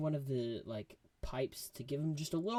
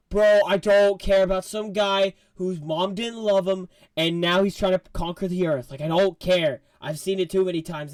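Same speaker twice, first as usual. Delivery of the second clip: loud, higher bro voice; Star-Lord retelling; 5 semitones higher, 12 dB louder than usual. Retold or imitated. Star-Lord waving off the villain, loud and higher. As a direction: bark it like a fed-up Star-Lord. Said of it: "Star-Lord's like".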